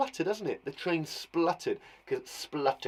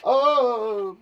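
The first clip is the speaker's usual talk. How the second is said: delighted; 8 semitones higher, 8 dB louder than usual. Real, not acted. Delighted teacher exclaiming.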